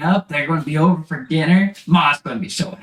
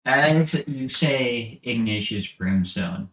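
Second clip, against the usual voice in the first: low haunting voice; 8 semitones lower, 5 dB quieter than usual. A narrator's reading. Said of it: "you hear a deeper voice".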